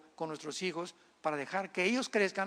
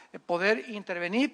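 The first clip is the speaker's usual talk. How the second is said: normal talking voice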